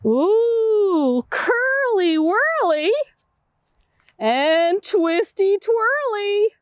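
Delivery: slow, bouncy read-aloud rhyme; sing-song rhyme. Acted. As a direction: savour the rhyming words for kids.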